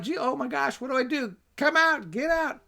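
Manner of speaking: high, hammed-up frantic flapping; mock panic. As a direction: play the panicked exorcist he is not. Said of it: "Jesus is casual. He's not freaking out".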